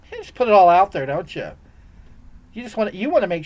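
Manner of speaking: loud, scornful jab at the viewer; sudden mock outburst. Mock scolding a chat viewer.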